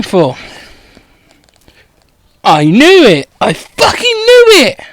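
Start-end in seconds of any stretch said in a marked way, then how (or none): 2.4-4.8 triumphant discovery. Acted. Hermione finds what she sought.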